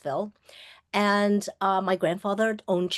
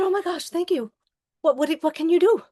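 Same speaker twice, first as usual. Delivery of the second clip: much higher, louder, rushing ahead; eager relief; 9 semitones higher, 5 dB louder than usual. Retold or imitated. Patient begging the doctor for help, high and eager. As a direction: tumble out the words in hope.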